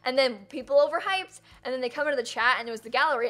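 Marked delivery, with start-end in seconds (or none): none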